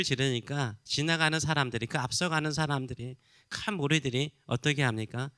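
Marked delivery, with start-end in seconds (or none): none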